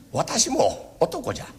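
ordinary talk

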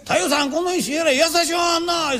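teasing customer. Retold or imitated. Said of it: "客もそない言うたん".

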